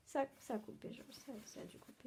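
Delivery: very soft, fading into a whisper; hushed realisation the call has dropped; muttering to oneself. Acted. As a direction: trail away as the line goes dead. Alone after the call drops.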